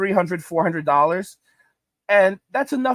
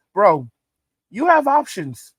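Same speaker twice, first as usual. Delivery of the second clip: emphatic scolding; 5 dB louder, slower than usual. Acted. Vlogger tells the squatter he has options, loud scolding. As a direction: lean in hard and scold him.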